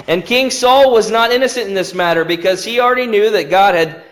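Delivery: normal talking voice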